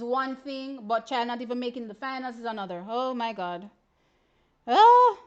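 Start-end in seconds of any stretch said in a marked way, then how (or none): 4.7-5.2 sudden high, loud oh; startled yelp of surprise; surprised cry. Real, not acted. Seeing the result, crying out in surprise.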